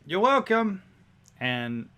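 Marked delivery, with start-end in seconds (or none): 0.1-0.8 high, loud driver's voice; carriage driver. Acted. Carriage driver answers a thankful passenger, high and loud. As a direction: call it back in character, pitched up.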